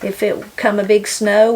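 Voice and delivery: ordinary talk, nothing marked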